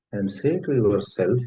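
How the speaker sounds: normal talking voice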